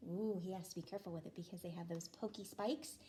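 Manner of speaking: usual speaking voice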